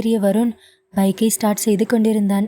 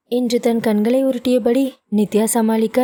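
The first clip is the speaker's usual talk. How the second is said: plain reading voice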